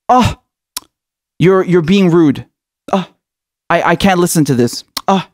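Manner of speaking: affected gasping "oh", halting and put-upon; mock offended. Establishment brushing off an angry complainant, mock offended. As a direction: act scandalised, with pauses between phrases.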